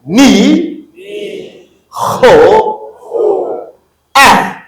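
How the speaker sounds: "Ni", "Ho", "A", each loud, high and separate; syllable drill. Shown as a demonstration. Teacher says repeat after me, loud separated "Ni", "Ho", "A". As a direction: call out "Ni", "Ho", "A" with pauses between. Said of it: "Après moi".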